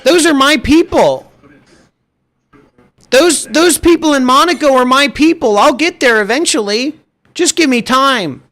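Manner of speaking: high, put-on whiny wannabe voice; mock whining. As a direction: imitate a spoiled kid insisting on the dream. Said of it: "your whinging and your whining".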